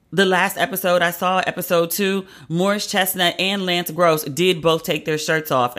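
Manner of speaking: usual speaking voice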